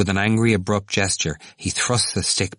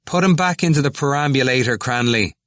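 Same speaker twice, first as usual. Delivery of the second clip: raised, high call; 7 semitones higher, 4 dB louder than usual. A narrator's reading. O'Keefe jeering at Temple to Cranley, calling out. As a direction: call it across the group, pitched up. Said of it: "O'Keefe called out".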